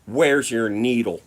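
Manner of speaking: loud, drawn-out interrogation; stern demand. Retold or imitated. Cop confronting passenger after search, stern demand. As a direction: demand it loudly and slowly, like a cop.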